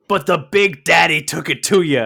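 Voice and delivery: loud, growly Big Daddy boast; wrestler promo voice. Retold or imitated. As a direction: brag like a cocky wrestler. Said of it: "he's talking about how".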